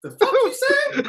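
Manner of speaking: high, slow, incredulous comeback; mock indignant. Missionary's imagined reply to taunting kids, indignant. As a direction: feign outrage at the kids. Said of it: "I'm just like".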